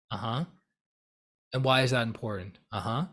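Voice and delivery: even, attentive questioning; listen and probe without reacting; neutral, unruffled. Shown as a demonstration. Hearing out someone's view, not worked up. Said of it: "You're not emotionally worked up".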